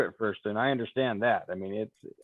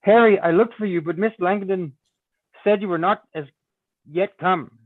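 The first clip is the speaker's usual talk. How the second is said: pitched-up, louder lady's voice; young lady voice; 6 semitones higher, 5 dB louder than usual. Acted. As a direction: play the eager young woman greeting Harry. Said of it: "So I think this is her".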